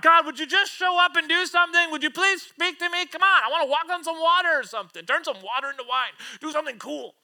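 high, loud, impatient plea to God; crying out. Acted. Believer demanding a sign from God, crying out. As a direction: beg heaven loudly, easing toward the end. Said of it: "find ourselves crying out to God".

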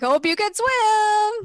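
high, slow singsong taunt; mock taunt. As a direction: drawl the jeer as he goes under. Guards tossing him into river.

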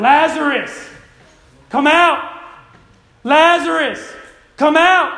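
high, booming call with pauses between; commanding shout. Acted. Preacher as Jesus calling Lazarus from the grave, loud shout. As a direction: shout it to wake the dead. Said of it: "Jesus wasn't whispering".

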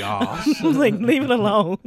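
high, loud, through laughter; laughing exasperation. Retold or imitated. Retelling her plea to mom about Spanish, laughing exasperation.

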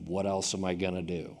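low, quiet, lifeless reply; blank deadpan. Retold or imitated. Miserable roommate answers his friend's question, blank deadpan. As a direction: imitate a miserable man with no energy. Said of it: "just blank".

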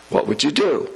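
normal talking voice

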